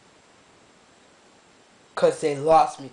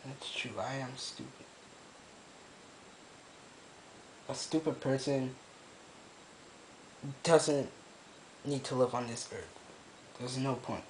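very soft, defeated self-blame; real quiet despair; hopeless murmur; 2 semitones lower, 19 dB quieter than usual. Real, not acted. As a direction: let the words sink away, nothing left. Hopeless self-loathing, very low voice.

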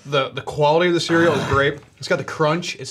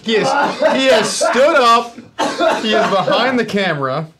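laughing; 7 semitones higher, 5 dB louder than usual. Real, not acted. Friend stood up, laughing.

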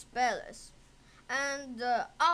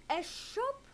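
put-on much higher, wondering boy; boy's voice; 10 semitones higher, 3 dB quieter than usual. A narrator's reading. Aladdin wonders about a shop, higher voiced.

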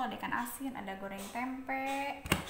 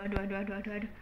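ordinary talk

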